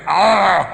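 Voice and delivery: imitated dog's noise, loud and long; mock animal moan; loud drawn-out groan. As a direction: groan it out at full volume.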